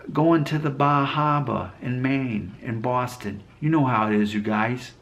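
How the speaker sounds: slow, badly faked Boston accent; horrible Boston accent. Shown as a demonstration. YouTuber demonstrates a Boston accent for viewers, horribly hammed-up. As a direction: do an awful Boston accent, drawn out. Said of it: "my horrible impression of, of, uh, that accent".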